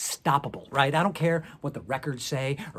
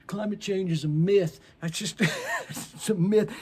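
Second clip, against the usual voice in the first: mocking denier; 6 semitones higher than usual. Comic mimicking climate deniers in a dismissive voice. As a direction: sneer it as a denier brushing off facts.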